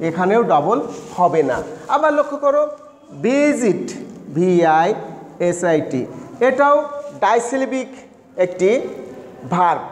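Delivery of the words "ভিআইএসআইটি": slow, lower letter-by-letter spelling; spelling aloud slowly. Shown as a demonstration. Teacher spelling listening slowly for students. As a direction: spell it out for the class.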